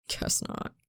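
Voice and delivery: low, breathy gruff mumble; gruff quiet reply; gruffly. A narrator's reading. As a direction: mutter it, half relieved. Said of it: "he says gruffly".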